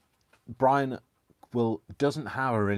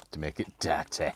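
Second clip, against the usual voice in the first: DIRTY in a put-on French accent; low, hammed-up French "dirty"; 7 semitones lower, 5 dB quieter than usual. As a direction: slip into saucy French on the word. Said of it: "I can't do it without saying it like that".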